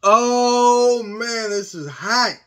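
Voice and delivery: slow, strained, loud groan from spice heat; burning mouth. Real, not acted. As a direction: groan through the burn. Mouth burning from hot sauce.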